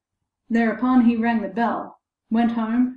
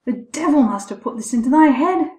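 raised, louder reproach; scolding; 4 semitones higher, 5 dB louder than usual. A narrator's reading. Terrified father to his boy, scolding. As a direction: scold him with mild heat. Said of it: "scolded the boy".

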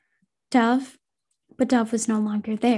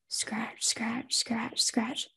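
soft whispered scratching; 11 dB quieter, much slower than usual. Acted. Unseen presence scratching at Casey, whispered. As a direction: breathe the scratches out quietly. Eerie scratching sound in dark room.

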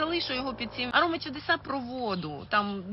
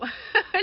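bright rising laugh; 10 semitones higher, 5 dB louder than usual. Real, not acted. Laughs at being called crazy.

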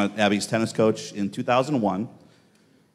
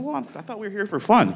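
much higher defensive protest; high mock-innocent protest; 12 semitones higher than usual. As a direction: plead innocence. Retold defensive reply.

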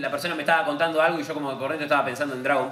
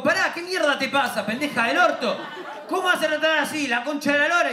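higher, hammed-up furious uncle; mock outrage; 11 semitones higher than usual. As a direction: blow up at the little girl. Uncle insulting niece in anger.

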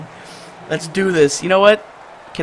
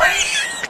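squealing laugh; 5 dB quieter than usual. Real, not acted. Laughs after surprise play.

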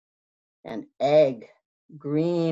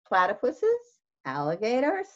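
ordinary delivery

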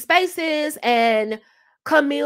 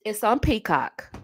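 ordinary delivery

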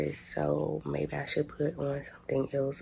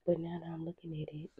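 whisper; 3 semitones higher, 9 dB quieter, faster than usual. Real, not acted. Quiet aside about outfit.

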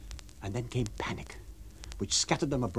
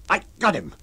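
a bit louder, triumphant call; mild shout; 6 semitones higher, 5 dB louder than usual. Acted. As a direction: call out that he has him. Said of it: "shouted Jaffers".

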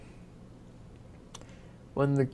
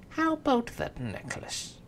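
much higher, sly imp voice; greedy imp; 12 semitones higher than usual. Acted. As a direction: ask with greedy glee.

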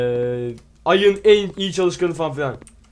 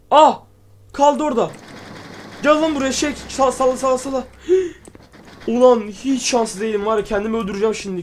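high, loud, exasperated yelling at the game; gaming gone wrong, shout it out in frustration; frustrated yelling; 7 semitones higher, 5 dB louder than usual. Real, not acted. Gamer yells at the stuck item, frustrated and loud.

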